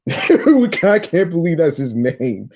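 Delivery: real laughing. Real, not acted. Host cracking up at the producer's name, laughing while reading. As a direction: try to read on through helpless laughter. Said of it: "sorry for laughing".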